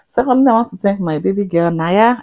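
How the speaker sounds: normal talking voice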